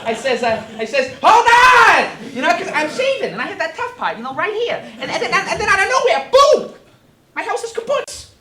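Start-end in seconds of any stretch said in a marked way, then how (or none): 1.2-2.1 high-pitched full-force holler; loud, panicked-sounding high yell. Acted. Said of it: "so I yell, uh". 2.3-8.3 loud, high, frantic retelling; breakneck, high-pitched excited testimony; worked-up pig witness. Acted. Pig recounts house blown down.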